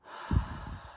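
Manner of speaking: soft slow sigh out; guided out-breath sigh. Shown as a demonstration. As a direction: release the breath gently. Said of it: "breathe in".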